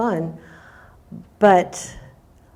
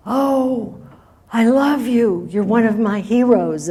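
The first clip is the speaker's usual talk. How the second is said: put-on higher, louder starstruck child; imitating a thrilled little fan; adoring little kid; 5 semitones higher, 4 dB louder than usual. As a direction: gush like a kid meeting a hero. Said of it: "there was this little kid in a wheelchair".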